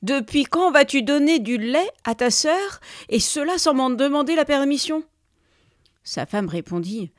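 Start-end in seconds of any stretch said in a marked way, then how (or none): 0.0-5.0 loud, higher-pitched angry demand; indignant shouting. A narrator's reading. Husband challenges his wife over the milk, indignant shouting. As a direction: demand an explanation, raising the voice. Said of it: "cria l'homme".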